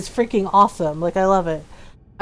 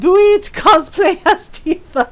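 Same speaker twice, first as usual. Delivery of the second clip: much higher, louder urging shout; eager urging; 7 semitones higher, 5 dB louder, slower than usual. Real, not acted. Streamer urging a viewer to cosplay Tifa, loud and eager. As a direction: egg them on loudly.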